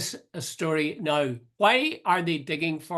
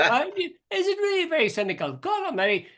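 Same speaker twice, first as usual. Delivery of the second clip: mock indignant; 12 semitones higher than usual. Real, not acted. Echoing cohost's "very cynical" remark.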